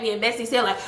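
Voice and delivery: normal talking voice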